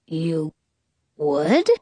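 low, halting disbelief; dumbfounded, dropping low, then questioning; stunned genie. A narrator's reading. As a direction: ask it in hushed shock. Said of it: "total shock".

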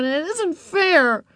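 put-on high, drawn-out girl's complaint; demanding. A narrator's reading. Beezus protesting to Mother about Ramona, high and aggrieved. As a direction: protest slowly in a high child's voice. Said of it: "Beezus demanded".

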